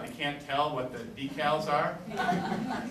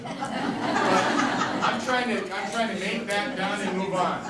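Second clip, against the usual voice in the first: laughing through the words, louder and higher; amused, words half laughed out; 5 semitones higher, 6 dB louder, much slower than usual. Real, not acted. Laughing while explaining.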